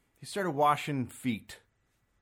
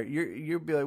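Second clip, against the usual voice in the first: ordinary talk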